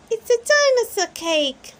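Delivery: put-on high, loud little-girl delight; thrilled little girl; excited Peppa. A narrator's reading. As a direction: squeal about the cake. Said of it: "says Peppa".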